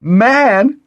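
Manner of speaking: very high, loud scornful whoop; derisive swoop. A narrator's reading. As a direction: swoop up in mocking disbelief. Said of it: "took a derisive swoop".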